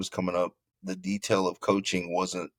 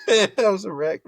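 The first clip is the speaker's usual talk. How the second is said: bursting into laughter, high and loud; laughing; 12 semitones higher, 7 dB louder than usual. Real, not acted. Player laughing at his freshman self to the host, laughing. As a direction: laugh out the line. Laughing repeat of wreck line.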